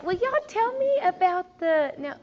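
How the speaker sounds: sugar-coat the question through clenched patience; mock sweet. Sweetly asking the kid back.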